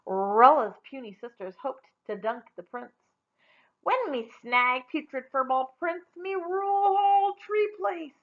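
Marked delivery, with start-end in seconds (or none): 3.8-8.1 gruff pidgin brag, lifted high; crude boasting yell. Acted. Older sister boasting about the prince, crude yell. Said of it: "yelled the older one".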